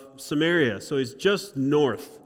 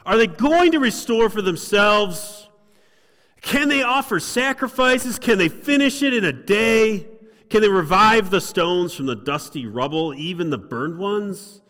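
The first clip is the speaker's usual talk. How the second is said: much higher and louder scornful tirade; angry mocking; 6 semitones higher, 7 dB louder than usual. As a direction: jeer at the builders like a furious governor. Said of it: "a tone of anger in his voice".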